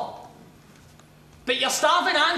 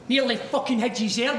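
ordinary delivery

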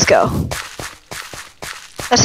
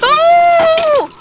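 startled cry; 13 semitones higher, 5 dB louder than usual. Real, not acted. Sudden in-game shock.

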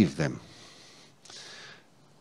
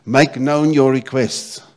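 proclaimed; 4 semitones higher, 7 dB louder, slower than usual. Acted. Preacher repeating verse emphatically.

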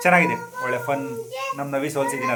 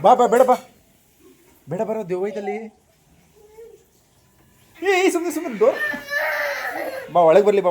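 high, loud calls with a laugh; calling out; 11 semitones higher, 7 dB louder than usual. Real, not acted. Calling child back inside. (ಮಗುವನ್ನು ಜೋರಾಗಿ ಒಳಗೆ ಕರೆಯುವುದು)

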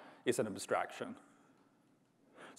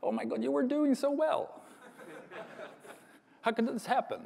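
high-pitched, hammed-up despairing parent; mock parental dismay; 13 semitones higher than usual. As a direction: wail it in playful, exaggerated disbelief. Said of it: "and it was like".

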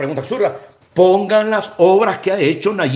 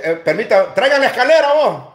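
mock mother calling out; 6 semitones higher than usual. Ahí viene la mamá de Álvaro Méndez.

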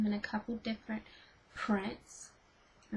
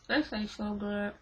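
talking while chewing candy; mouth full. Real, not acted. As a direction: speak through a mouthful of chocolate. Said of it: "I'm sorry for talking with my mouth full".

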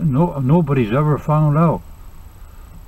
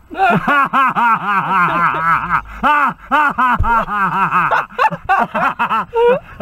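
hearty laughter; 4 semitones higher than usual. Real, not acted. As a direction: burst out laughing at your own joke. Cracks up at own joke.

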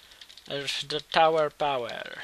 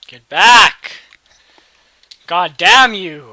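much louder, slow, dragged-out curse; furious yell; 4 semitones higher, 20 dB louder, much slower than usual. Real, not acted. Gamer screaming at his rival to get back, furious. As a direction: bellow at him.

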